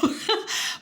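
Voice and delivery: light airy giggle; a short giggle at her own spending; high breathy laugh. Real, not acted. Laughs after spending everything.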